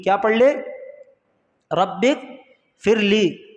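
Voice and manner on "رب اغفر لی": very slow, spaced-out recitation; slow dictation. Shown as a demonstration. Teacher repeating the prayer after asking learners, very slow dictation. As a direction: stretch the prayer out piece by piece.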